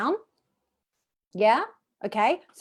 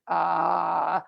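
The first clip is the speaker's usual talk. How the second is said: croaky ah; 5 semitones lower than usual. Shown as a demonstration. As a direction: lower croaky ah, tight throat. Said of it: "when you go croaky".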